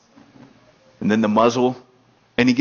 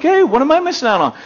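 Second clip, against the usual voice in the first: put-on curious kid; 12 semitones higher, 4 dB louder, faster than usual. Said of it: "I'm like".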